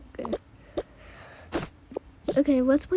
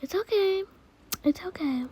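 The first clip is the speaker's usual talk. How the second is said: soft, higher cooing; soothing a baby; 8 semitones higher, 4 dB quieter than usual. Pretend. Older sibling comforting a crying baby sister, soft cooing. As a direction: reassure gently in baby talk.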